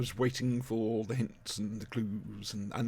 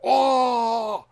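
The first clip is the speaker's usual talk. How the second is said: loud, high theatrical bellow; big stage bellow; 10 semitones higher, 7 dB louder than usual. Shown as a demonstration. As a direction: belt it out like an eighties actor. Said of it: "stand with your legs far apart and bellow".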